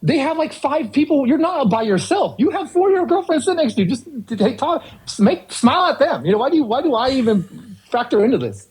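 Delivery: fed up with needy customers, snap back; exasperated retort. Retold or imitated.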